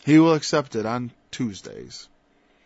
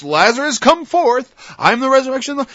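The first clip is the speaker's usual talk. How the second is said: a much higher, louder ringing call; Jesus calling Lazarus; 12 semitones higher, 9 dB louder than usual. Retold or imitated. Jesus commanding Lazarus to rise, ringing call. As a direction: summon the dead man from the tomb. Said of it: "Like Jesus said to Lazarus".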